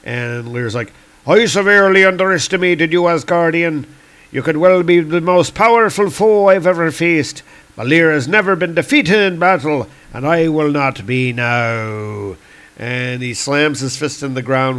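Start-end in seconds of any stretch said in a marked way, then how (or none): 1.3-12.4 higher, proud warrior declamation; defiant Celtic god. Acted. Lear refusing defeat by Thor, proud and defiant. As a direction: boast as an undefeated comic-book god, slowing at the close.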